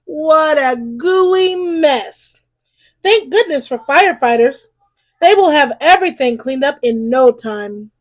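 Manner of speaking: ordinary delivery, nothing marked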